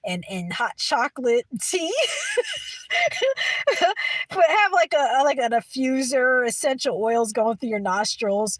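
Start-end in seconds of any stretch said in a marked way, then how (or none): 2.0-4.2 very high squealing laugh; real laughter. Real, not acted. Streamer delighted by her snow-day daydream, squealing laughter.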